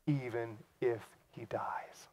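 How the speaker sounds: slow whispered close. Acted. Preacher quoting Jesus on life after death, slow whisper. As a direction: breathe the promise.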